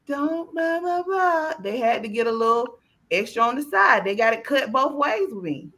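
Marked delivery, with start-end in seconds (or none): 0.1-1.5 higher, slow, sugary mama voice; mock nice mama. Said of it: "They got the nice mama".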